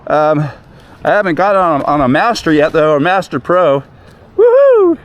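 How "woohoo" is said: high joyful whoop, thrown out loud; excited whoop. Real, not acted. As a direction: let out a happy yell mid-ride. Cheering while riding fast.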